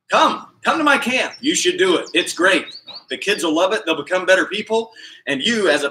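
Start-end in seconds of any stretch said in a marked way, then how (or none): 0.1-1.3 eager invitation. Real, not acted. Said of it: "I don't even care if I bother people".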